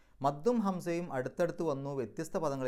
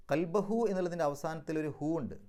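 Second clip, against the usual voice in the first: usual speaking voice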